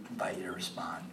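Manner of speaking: whispered, very soft; whispered invitation. Real, not acted. Preacher inviting the congregation to respond, whispered.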